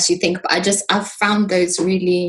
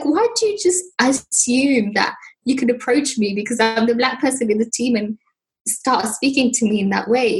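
ordinary delivery

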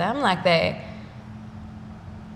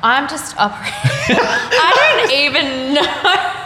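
laughing; 7 semitones higher, 7 dB louder than usual. Real, not acted. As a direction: laugh helplessly at the question. Laughing at the question.

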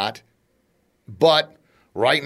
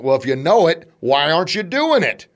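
scolding himself; 6 semitones higher, 5 dB louder than usual. Retold or imitated. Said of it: "It's like".

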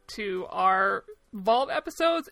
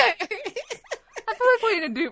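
laughing; 12 semitones higher, 3 dB louder, slower than usual. Real, not acted. Laughing at their film choices.

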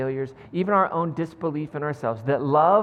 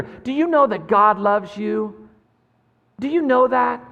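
emphatic question; 4 semitones higher, 6 dB louder, slower than usual. Acted. Preacher voicing imagined Jesus.

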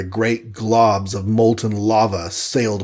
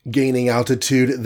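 usual speaking voice

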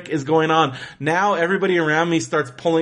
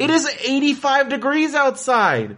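exasperated high rant; 8 semitones higher than usual. Real, not acted. Venting about heat in line.

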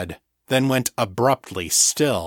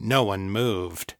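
usual speaking voice

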